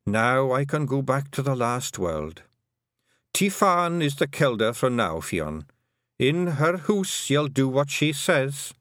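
put-on firm old Scots mother, slow at the end; old Scottish Kelda. A narrator's reading. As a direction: give the order to her daughter. Kelda hands over.

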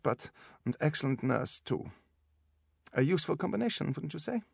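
put-on high-pitched German-accented specialist; businesslike instructions in a German accent; brisk German doctor. A narrator's reading. German doctor figure speaks.

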